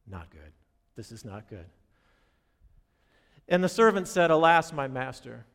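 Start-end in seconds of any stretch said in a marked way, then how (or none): 0.1-1.7 low, much softer, sinking "not good"; muttered dread. Retold or imitated. Servant facing the enemy army, quiet dread. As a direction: barely voice the dread under your breath.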